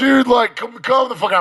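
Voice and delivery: high-pitched, exasperated protest; exasperated plea. Retold or imitated. Storyteller replaying his reaction to the group, exasperated. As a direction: plead in disbelief, pitched well up.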